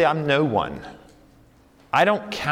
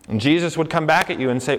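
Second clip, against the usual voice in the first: normal talking voice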